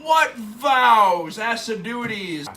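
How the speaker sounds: loud, high theatrical exclamation; mock-grand outcry; hammed-up declaiming. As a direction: declaim it big, like a stage actor. Declaiming a play line.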